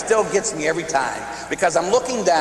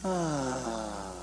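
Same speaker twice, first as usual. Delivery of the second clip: soft, much quieter satisfied ahh; contented little sigh; 3 semitones lower, 11 dB quieter than usual. Retold or imitated. The niece sighs in her uncle's hug, very soft. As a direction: breathe out happily, very soft. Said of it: "and she'd go".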